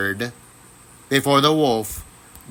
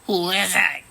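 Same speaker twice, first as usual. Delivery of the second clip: a lightly cackly, higher wolf voice; 6 semitones higher than usual. A narrator's reading. Wolf at the door calls out, a hint of a cackle. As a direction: cackle the question like a sly old wolf. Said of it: "he called out in a cackly voice".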